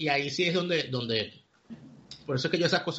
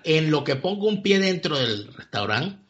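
normal talking voice

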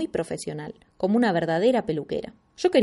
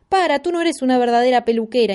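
loud high begging plea; pleading cry; 4 semitones higher, 6 dB louder than usual. Acted. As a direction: implore her to stop. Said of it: "imploré". (súplica en voz alta)

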